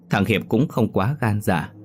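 ordinary delivery

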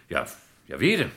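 usual speaking voice

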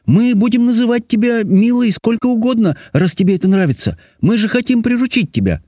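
high-pitched, affectionate princess voice; girlish high voice, kindly soothing; coaxing princess. A narrator's reading. Princess consoling the dragon.

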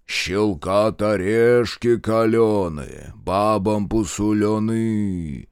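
slow, drawn-out ritual chant; trained orator voice. A narrator's reading. Minkult leads the ritual, stately intoning. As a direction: intone it slowly like a ceremony leader. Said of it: "заговорил поставленным голосом".

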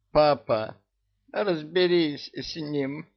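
put-on drunk. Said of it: "пьяно мотнул головой".

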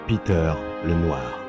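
usual speaking voice

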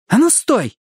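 high, sharp angry yell; angry shout. Acted. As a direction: yell at him to stop. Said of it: "сердито крикнула она". (сердитый крик)

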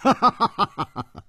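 高声爽朗地笑一下; 笑出声来，透着得意; 得意的笑. Acted. 楚留香得意地笑. (楚留香识破后得意大笑)

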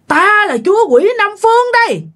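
very high, strong demon lord proclamation; high, forceful demon voice. Retold or imitated. Demon king in the possessed mother's body, high and forceful. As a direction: proclaim it high in one unbroken sweep. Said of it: "nó cao và nghe nó mạnh mẽ".

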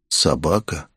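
dropped-pitch bewilderment; puzzled echo. A narrator's reading. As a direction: echo it to yourself, baffled. Narrator's puzzled echo of her words.

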